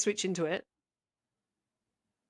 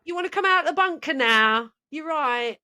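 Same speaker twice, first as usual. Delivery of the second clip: put-on northern London accent; 3 semitones higher than usual. Host calls down chimney to bunker cult, mock London accent. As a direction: shout it down the chimney like a cheeky Londoner. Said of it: "moved to, like, northern London there".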